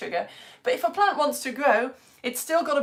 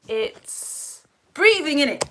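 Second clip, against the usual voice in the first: slow, drawn-out, higher unsure pupil; pupil's answer; 5 semitones higher, 5 dB louder, slower than usual. Pretend. Teacher voicing a pupil's slow answer, a touch higher. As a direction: play a hesitant kid answering.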